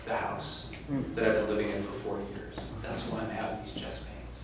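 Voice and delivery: mild hushed tearful telling; a touch of a patient near tears, voice dropping away; quietly imitating a man starting to cry; retold crying man. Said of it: "And he starts to cry".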